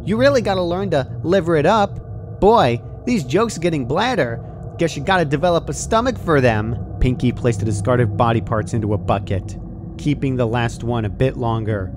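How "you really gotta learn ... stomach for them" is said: high, bouncy pun-telling voice; Pinky's comedy routine. A narrator's reading. Pinky ramping up her routine over Dash, high and bouncy. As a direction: deliver the puns like a gleeful stand-up.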